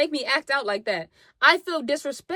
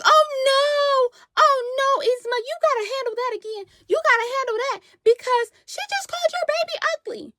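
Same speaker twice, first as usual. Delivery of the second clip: much higher, squealing instigator; mock high egging-on; 13 semitones higher than usual. Friend urges her to handle the insult, high squeal. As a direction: squeal to stir up the fight.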